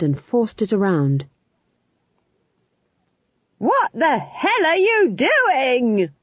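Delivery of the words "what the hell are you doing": high, drawn-out roar of disbelief; stretched, high-pitched outraged yell. A narrator's reading. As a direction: bellow it slowly over the wind. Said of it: "roared Nanny".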